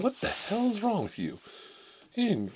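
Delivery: mock outrage at a snob; high-pitched exasperated ranting; incredulous rant. Ranting at pompous classmate.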